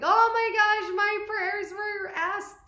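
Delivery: high, loud overjoyed gasp; thrilled high squeal; excited squeal. Retold or imitated. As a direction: squeal it like a prayer was answered. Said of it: "I'm like".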